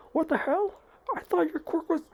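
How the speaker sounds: high-pitched startled questioning; acted villain disbelief. Sludge villain partly frozen by Deku, acted startled protest. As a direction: react in high-pitched shock at the attack.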